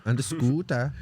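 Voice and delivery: short imitation of a Norwegian accent; mock Norwegian accent. Echoing a friend's line from the film, mock Norwegian accent. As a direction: echo it in Norwegian-accented English. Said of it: "you guys are better at that".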